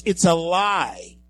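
forceful, slow "It's a lie"; strong accusation. Retold or imitated. Host to audience, hammering the accusation hard. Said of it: "I may have said it a little bit stronger".

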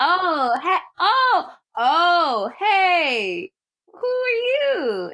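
high, drawn-out, startled 'oh, hey'; mock innocent American. Villain pretends to be a hostage, mock-innocent American. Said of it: "Gruber is trying his best to be an American man".

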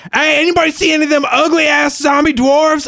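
high, loud shouting to draw attention; brash, high-pitched yell; screaming taunt. Acted. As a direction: holler down the hallway at the monsters. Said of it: "like, screaming".

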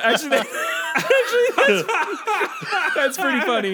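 high, loud words through real laughter; laughing hard. Real, not acted. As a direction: crack up while trying to talk. Cracks up at own joke.

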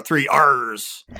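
slow, low growly pirate "three R's". Shown as a demonstration. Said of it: "I like to do it in my pirate voice".

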